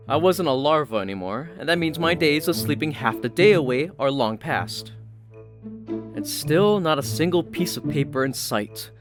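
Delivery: usual speaking voice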